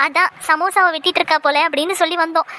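normal talking voice

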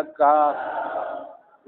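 काफ very slow and stretched; Arabic pronunciation. Shown as a demonstration. Teacher modelling काफ for the class. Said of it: "इसका तलफ़ुज़ क्या है".

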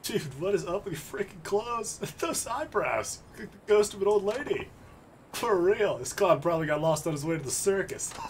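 mocking street thug. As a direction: jeer like a cocky thug. Voicing a thug mocking an outfit.

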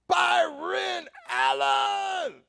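long, drawn-out high bellow; booming announcement, high and stretched; shout for the whole room. Retold or imitated. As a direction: holler a guest's name across a party. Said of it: "wants the whole party to hear this".

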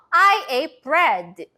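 very slow, clearly spaced sentence; slow model sentence. Shown as a demonstration. Teacher modelling the sentence for kids to repeat aloud. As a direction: say it slowly for repeating. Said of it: "큰 소리로 같이 얘기해 봐요".

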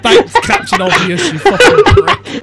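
high-pitched, through laughter; laughing jeer. Real, not acted. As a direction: jeer at a friend while cracking up. Mocking a friend through laughter.

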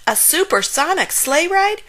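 high, wondering question; amazed gasp. A narrator's reading. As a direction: pitch it up in astonished disbelief. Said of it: "he gasped".

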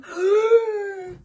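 huge, high, loud gasp; exaggerated gasp of astonishment; mock shocked gasp. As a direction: gasp loudly as if stunned by the reveal. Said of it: "you're like".

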